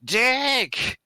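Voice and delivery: high, drawn-out call through a door; loud indoor Wookiee. Acted. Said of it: "use my loud indoor Wookiee voice".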